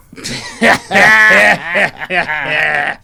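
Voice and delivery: long, loud, high forced guffaw; hammed-up biker laugh. Bikers laughing at their sushi joke, loud hammed-up cackle. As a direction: roar with put-on biker laughter.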